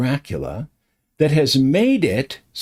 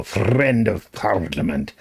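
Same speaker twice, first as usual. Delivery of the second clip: low Scottish burr, R's rolled long; 6 semitones lower than usual. Retold or imitated. Showing how his friend rolled R's, Scottish accent. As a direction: roll the R's in "friend" and "parliament" like a Scot. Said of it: "he'd roll those R's".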